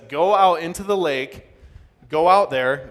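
projected, emphatic order to set out; loud command. Acted. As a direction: send them off with a forceful call. Voices Jesus sending disciples out.

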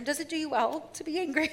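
put-on soft, quick, patient parent to a tantruming child; mock calm to a toddler. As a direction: ask it softly like calming a toddler. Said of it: "ask your two-year-old".

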